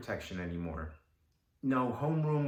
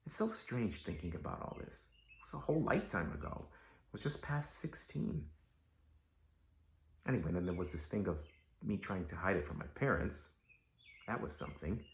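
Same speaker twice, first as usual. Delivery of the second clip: soft, lowered, inward voice; subdued reflection; 4 semitones lower, 7 dB quieter than usual. Acted. Dancer looking back on a lifetime ago, hushed and reflective. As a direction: drop right down, as if thinking back privately. Reflecting after reliving his audition.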